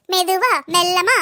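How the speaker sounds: slow, stretched-out words; mock-slow soothing; drawn out slowly. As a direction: say it very slowly, like coaxing. Sister-in-law telling her to slow down.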